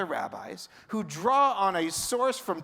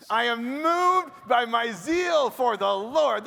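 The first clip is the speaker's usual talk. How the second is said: loud, slow proclamation, pitched higher; rabbi voice, shouted; 5 semitones higher, 6 dB louder, slower than usual. Acted. Rabbi leading the crowd in Elijah's zealous cry. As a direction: shout it out with the congregation. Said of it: "You wanna say that with me?".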